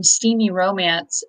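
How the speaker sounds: normal talking voice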